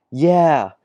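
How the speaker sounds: high, loud cartoon cheer; Luffy cheering. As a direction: yell it up high like an overjoyed boy hero. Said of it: "Luffy says".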